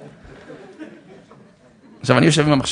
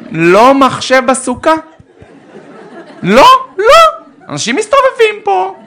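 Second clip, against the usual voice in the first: much higher, slow, loud nagging voice; scolding family member; 7 dB louder, slower than usual. Retold or imitated. Relative scolding the father about the laptop, high, slow nagging. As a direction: drag out the told-you-so scolding. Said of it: "אמר לי".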